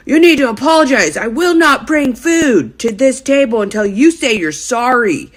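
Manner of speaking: heated mock scolding; waiter blowing up. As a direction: imitate an irate waiter laying down the law. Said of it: "blows up even more".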